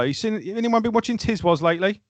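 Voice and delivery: lightly voiced singer joking with the audience; imitated stage banter. Singer to the audience at a first gig, joking. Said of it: "that classic one with that first gig".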